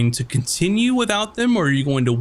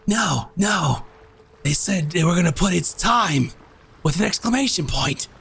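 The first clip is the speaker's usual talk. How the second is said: Chowder; 4 semitones higher than usual. Acted. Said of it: "And then Chowder goes".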